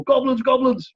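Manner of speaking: high-pitched mock shout; mock alarm call. Stuntman calling to his partner in a fight, mock shout. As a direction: call it out urgently, well above normal pitch. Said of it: "we get a fight scene and we go".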